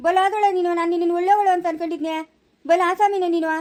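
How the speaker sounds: a husband defending his wife, shouting in outrage; angry shout. Acted. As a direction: yell it right at her face. Husband quarrels with sister.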